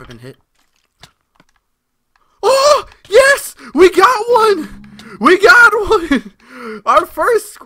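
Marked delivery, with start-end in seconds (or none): 2.4-7.6 very high, loud, drawn-out cheers; real ecstatic shouting. Real, not acted. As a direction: scream it out in triumph. Celebrates first rare drop in game.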